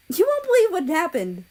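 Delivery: bursting with excitement; exclaiming. A narrator's reading. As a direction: blurt out the big news. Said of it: "she exclaimed".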